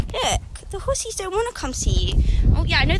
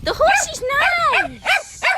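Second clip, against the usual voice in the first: way up high and louder; 12 semitones higher, 6 dB louder, slower than usual. Real, not acted. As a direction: coo it to the horse. Talking to horse.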